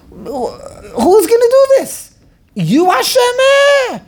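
high, stretched-out rhetorical outcry; hammed-up challenge, pitched high; mock incredulous challenge. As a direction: throw it out in exaggerated disbelief.

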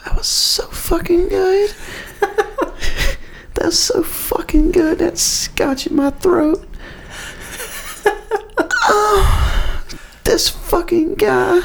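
high slow whispered savoring; breathy slow ASMR whisper. Acted. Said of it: "do the whole A S M R thing again".

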